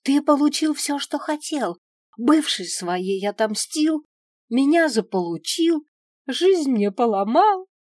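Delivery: high, loud, hurt outburst; wounded, furious tirade; anguished accusation. A narrator's reading. Accusing him at quarrel's peak.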